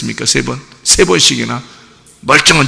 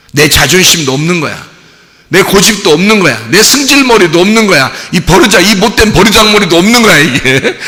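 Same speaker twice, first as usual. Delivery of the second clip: rising louder and higher line by line; building emphasis; 5 semitones higher, 5 dB louder than usual. Real, not acted. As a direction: build each line into a near shout. Building preached list, rising. (점점 높아지는 외침)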